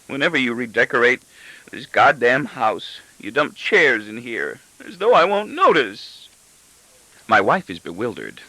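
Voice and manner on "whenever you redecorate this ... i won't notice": petulant tantrum. Acted. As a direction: whine it out like a sulking child. Husband's tantrum at his family.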